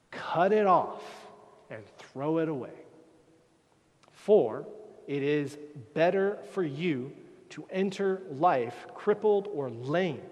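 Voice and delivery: loud, slow, emphatic reading of the warning; forceful scripture reading. Acted. Preacher reading Jesus' warning to the congregation, forceful declamation. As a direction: declaim each command like a sentence being passed.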